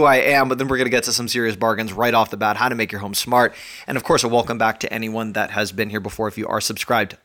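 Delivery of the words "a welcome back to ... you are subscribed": ordinary delivery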